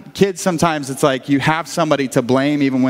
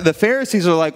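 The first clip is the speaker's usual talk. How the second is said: normal talking voice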